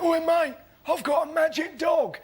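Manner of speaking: high, eager call after someone; homeless bloke calling out. Retold or imitated. Homeless bloke to a man walking away, calling out. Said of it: "walking away and he went".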